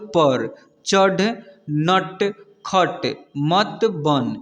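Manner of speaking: slow teacher's reading, each word drawn out; measured dictation-style reading; word-by-word reading demo. Shown as a demonstration. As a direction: read slowly, pausing between words for learners. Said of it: "इसको कैसे पढ़ते हैं".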